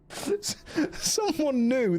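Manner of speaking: high, slow laughing voice. Real, not acted. Streamer amused by a newcomer in chat, laughing as he talks. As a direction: barely get it out through laughter. Cracking up over chat's reaction.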